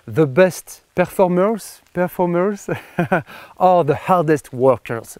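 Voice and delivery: slow, hammed-up English accent; mock English accent. Shown as a demonstration. Finishing Magic Johnson's quote for viewers, in a slow mock English accent. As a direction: draw out the English words in a playful English accent. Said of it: "je vais le dire avec mon super accent anglais".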